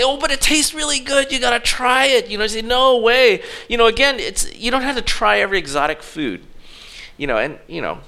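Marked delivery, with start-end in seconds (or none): 0.0-2.3 high, mildly hyped-up urging; eager persuading. Acted. Friend urging someone to try exotic food, eagerly persuading. 2.6-3.4 high, loud mock refusal; emphatic refusal. Answering a friend pushing exotic food, emphatically refusing.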